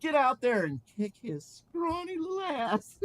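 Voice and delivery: high villain holler; Laughing Man yelling. Acted. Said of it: "He yelled".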